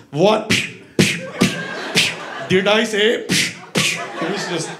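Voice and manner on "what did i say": short, raised-pitch words between slaps; punctuated, Indian-accented scolding; scolding grandpa. Acted. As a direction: fire out each word with a slap. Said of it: "he would slap us like Three Stooges".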